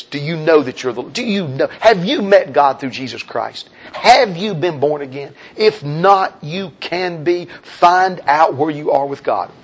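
ordinary talk

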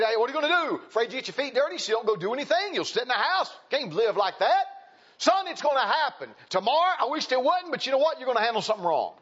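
high, urgent pulpit exhortation; fiery sermon charge; full-force preaching. Real, not acted.

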